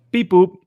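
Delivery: high, loud beeping noise; beep boop robot. Retold or imitated. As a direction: make a clicker beep sound. Mimicking a device clicking.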